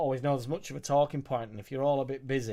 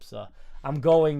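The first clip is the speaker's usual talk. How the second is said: pub guy; 9 dB louder, slower than usual. Pretend. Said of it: "now I feel like the guy from the pub".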